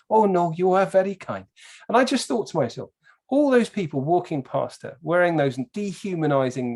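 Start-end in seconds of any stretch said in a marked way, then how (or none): 0.1-1.4 put-on higher Welsh-accented thanks from an old lady. Old lady thanking her helper, high Welsh lilt. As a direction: imitate a grateful little Welsh woman, pitched up. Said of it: "The little old lady was on point".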